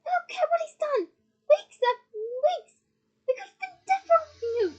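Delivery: high, loud, anguished tirade; furious scream. A narrator's reading. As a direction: scream your outrage, dragging key words. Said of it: "she screamed".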